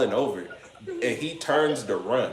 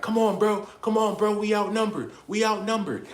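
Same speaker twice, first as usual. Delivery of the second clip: much higher, pleading hurry-up voice; imitating urging cousin; 9 semitones higher than usual. Cousin urging him to leave because they're outnumbered, high pleading. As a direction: voice the cousin pulling him out of the fight.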